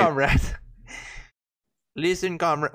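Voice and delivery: amateur mock Russian accent; hammed-up Russian comrade. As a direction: try out a stock Russian voice. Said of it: "do a Russian voice".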